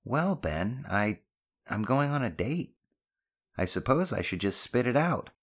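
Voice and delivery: mother easing into an embarrassing admission; self-conscious mother. Acted.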